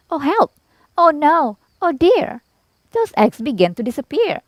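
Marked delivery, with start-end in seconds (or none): 0.1-2.4 high, dramatic storybook alarm; mock alarm. A narrator's reading. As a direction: exclaim in mock panic for the eggs. Alarm as eggs vanish.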